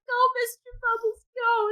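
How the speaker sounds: put-on high-pitched whining child in tears; hammed-up kid's wail for a lost pet; mock crying. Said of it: "It's, like, crying".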